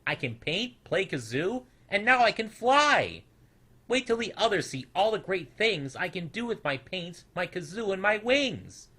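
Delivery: high, rising excitement; can't wait to show everyone; excited little snoodle listing his tricks; eager little boast. A narrator's reading. Snoodle boasting new skills.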